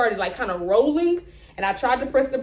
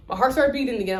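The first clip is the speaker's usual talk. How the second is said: ordinary talk, nothing marked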